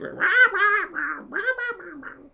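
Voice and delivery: high squawking parrot screech, far up. Retold or imitated. Mimicking the parrot.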